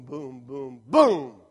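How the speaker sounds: booming drum hits with the voice, the last one loud; be the drummer hitting the beat; drumbeat imitation. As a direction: boom boom like a bass drum. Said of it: "Sean accents every Sunday for us on the drums".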